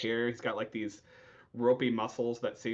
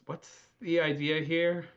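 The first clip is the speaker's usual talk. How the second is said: slow, pitched-up questioning; slow calm challenge; 6 semitones higher than usual. Acted. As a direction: calmly question the attacker. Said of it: "He just goes".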